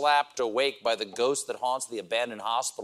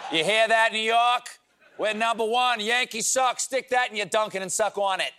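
a hammed-up Boston accent, louder and higher at the start; 8 semitones higher, 3 dB louder than usual. Host taunts New York fans in a mock Boston accent. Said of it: "my attempt at a Boston accent".

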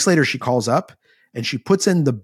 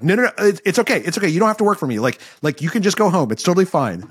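retold freaked-out reassurance; 5 semitones higher, faster than usual. Recruiter letting a crying woman off the hook, imitated freaked-out. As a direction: imitate someone frantically backpedalling, pitch jumping up. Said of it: "is so freaked out. He's like".

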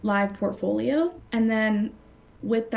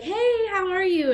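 bubbly, higher-pitched greeting; 10 semitones higher than usual. Retold or imitated. Eager candidate greeting stone-faced interviewers, bubbly. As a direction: chirp a bright, bubbly hello. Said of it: "I was bubbly".

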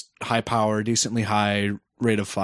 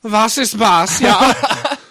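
laughing; 12 semitones higher, 9 dB louder, much slower than usual. Real, not acted. Repeating the funny name laughing.

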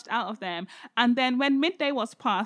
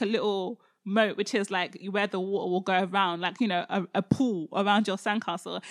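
ordinary talk, nothing marked

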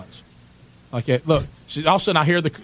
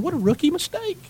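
mock scolding. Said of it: "I'm sitting there going".

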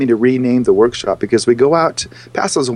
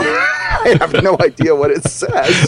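laughing; 5 semitones higher, slower than usual. Real, not acted. Laughs about Chinese translation.

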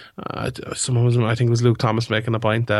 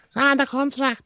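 much higher, slower, laughing squeak; laughing mock echo; slower than usual. Host echoing the cohost's jab at George, laughing, high and silly.

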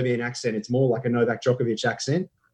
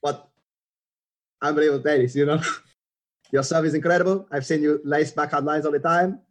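put-on slightly higher, foreign-accented athlete voice; tennis star impression; 4 semitones higher than usual. Impressionist praising guest's serve and backhand, accented player impression. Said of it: "Have you ever thought about doing".

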